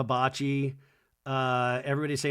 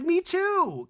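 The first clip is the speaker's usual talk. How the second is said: put-on very high, louder breathy-girl squeak; mock high girly reply; mock Lexi; 4 dB louder than usual. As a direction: squeak it like a starry-eyed girl.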